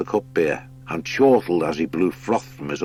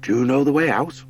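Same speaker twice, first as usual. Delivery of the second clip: higher, eager hare voice; 6 semitones higher than usual. Acted. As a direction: push to get going. Hare Blench speaks.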